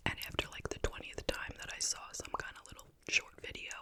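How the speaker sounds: usual speaking voice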